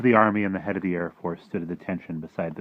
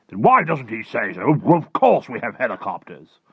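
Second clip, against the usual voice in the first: loud, raised military voice; exasperated Air Force chief; 5 semitones higher, 10 dB louder than usual. Acted. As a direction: retort loudly as an impatient officer.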